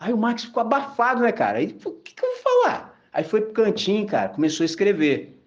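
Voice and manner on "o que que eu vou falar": much higher, flustered, put-on voice for Max; panicked question. Max, put on the spot at the party, panicking. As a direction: play Max as a nervous guy caught off guard.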